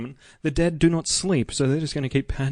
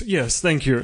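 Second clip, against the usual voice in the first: ordinary delivery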